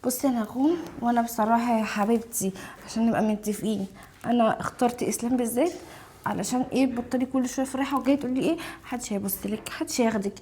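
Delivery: soft, low, mocking taunt; spiteful gloating. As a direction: twist the knife quietly. Wife gloats to her rival friend. (شماتة بصوت منخفض)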